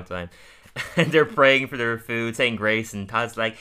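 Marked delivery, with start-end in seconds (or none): none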